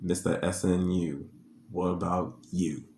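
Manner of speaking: soft, smug, low mock taunt; smug murmur. The other lady taunting the bragging mom, soft and smug. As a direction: murmur it with a smug little dig. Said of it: "she just sat there and go".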